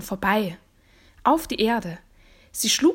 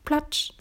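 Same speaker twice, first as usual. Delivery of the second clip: short wet slap, raised above normal speech; imitated splat; 6 semitones higher than usual. A narrator's reading. Frog climbing the marble steps, splashy imitation.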